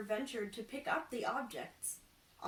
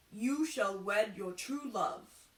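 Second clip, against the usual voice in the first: fortune teller voice; 6 dB louder, slower than usual. Acted. Fortune teller to the carpenter, slow mystic intoning. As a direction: intone it like a solemn prophet.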